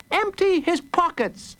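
put-on very high little boy's voice; high-pitched boyish command; child hero's order. A narrator's reading. Child hero gives an order.

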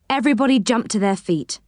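usual speaking voice

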